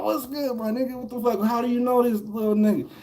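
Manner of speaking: high, bright surprised reply; excited greeting. Retold or imitated. The narrator asking the big homie how he knows the kid, high and excited. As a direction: greet him back, puzzled and thrilled.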